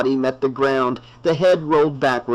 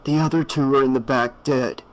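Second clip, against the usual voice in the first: ordinary delivery, nothing marked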